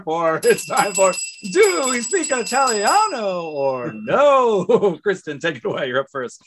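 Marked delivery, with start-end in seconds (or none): 0.4-5.0 game show host. Pretend. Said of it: "it's time to play everybody's favorite game".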